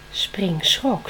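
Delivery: ordinary delivery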